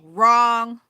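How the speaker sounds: loud, flat-out contradiction; a sudden loud verdict cutting off the daydream; emphatic wrong. Real, not acted. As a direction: slam the word down hard. Blunt retort to her own assumption.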